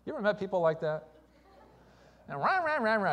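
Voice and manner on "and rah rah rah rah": high-pitched nonsense griping; caricatured grumbler going on and on; mock complaining. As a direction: imitate endless whining with babble syllables. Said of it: "murmuring and complaining".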